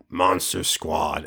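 low, slow trailer announcer voice; movie announcer. Retold or imitated. Quoting the trailer, deep announcer voice. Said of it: "the dumbest I've ever sounded".

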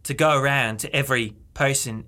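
put-on Aussie storytelling; Australian accent. Telling a friend about the bar prank, put-on Australian accent. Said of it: "today in Australian accent".

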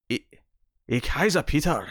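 halting, effortful Scottish-accented German. Shown as a demonstration. Trying to make a German phrase sound Scottish, Scottish accent. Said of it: "make that sound Scottish".

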